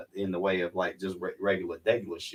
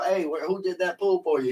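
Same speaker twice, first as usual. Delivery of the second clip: high, put-on voice of a hotel guest; rich guest voice; 9 semitones higher than usual. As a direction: ask it like a curious rich guest. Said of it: "they're gonna ask the guy at the hotel".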